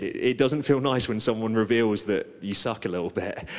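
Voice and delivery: usual speaking voice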